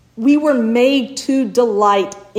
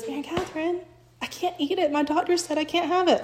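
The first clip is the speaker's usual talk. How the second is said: higher, much softer, a timid niece declining; sad refusal; 6 semitones higher, 7 dB quieter than usual. Retold or imitated. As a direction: say it small and sorry, like a girl who can't eat dessert. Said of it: "Leela goes".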